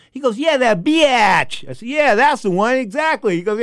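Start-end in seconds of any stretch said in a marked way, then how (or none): 0.4-1.7 high, loud, drawn-out jeer; gleeful insult. Retold or imitated. Fighter recalling host's ex-wife, loud drawn-out scorn. 1.9-3.4 high, loud confirmation; eager agreement. Retold or imitated. Host to fighter who remembers the ex-wife, loudly eager.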